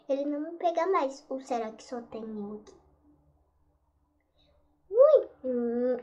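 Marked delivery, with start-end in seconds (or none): 4.9-5.3 startled yelp. Real, not acted. Killed by monster.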